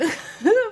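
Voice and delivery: sudden high loud giggle; loud high laugh. Real, not acted. Laughs at own phrasing.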